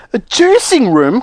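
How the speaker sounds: high, drawn-out disbelieving question; horrified cry. Acted. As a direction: echo it slowly in alarm. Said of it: "cried Missus Beauregard".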